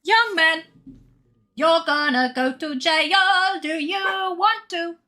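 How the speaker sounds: high, drawn-out sing-song threat; high sing-song telling-off; mock scolding. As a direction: playfully scold the dog in a high, slow croon. Playfully scolding her dog aloud.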